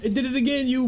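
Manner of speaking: high, loud outburst; frustrated yell. Real, not acted. Player yells at the game glitch, loud and high.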